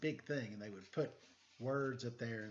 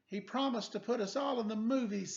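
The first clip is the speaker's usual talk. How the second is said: higher, eager character voice; light character voice; 8 semitones higher, 3 dB louder than usual. Acted. Letter B explaining to the detective, eager. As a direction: say it with hopeful eagerness.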